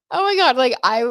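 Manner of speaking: high, loud burst of emotion; excited. Real, not acted. Recalling tearing up at the restaurant, excited. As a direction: gush as the memory overwhelms you.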